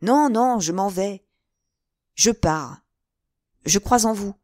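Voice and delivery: loud, emphatic leave-taking; abrupt, resolute outburst. Acted. D'Artagnan forcing himself to leave, loudly resolute. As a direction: blurt it out, tearing yourself away. Lover tears himself away.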